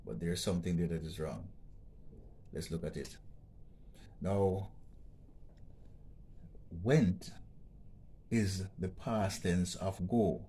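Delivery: normal talking voice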